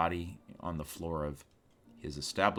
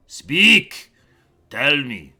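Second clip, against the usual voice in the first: sudden much louder, higher shout; captain's barked command; 8 semitones higher, 18 dB louder, much slower than usual. Acted. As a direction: snap the order at them. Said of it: "looks at you and goes".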